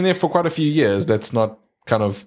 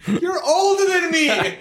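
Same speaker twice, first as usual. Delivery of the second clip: laughing outrage; slower than usual. Real, not acted. Mock outrage at birthday tease.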